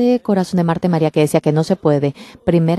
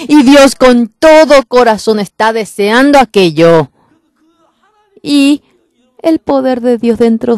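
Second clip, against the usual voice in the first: fervent preaching; 3 semitones higher, 5 dB louder than usual. Real, not acted. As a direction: rouse the congregation. Preaching climax to congregation.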